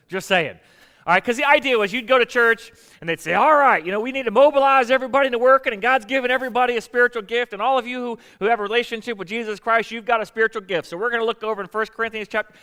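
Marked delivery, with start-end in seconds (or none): 3.3-12.5 higher, louder Southern preacher voice, a bit fast at first; Southern preacher talk. Retold or imitated. Pastor mimicking the church that hands out gift surveys, Southern preacher voice. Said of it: "I don't know why I go into Southern preacher talk".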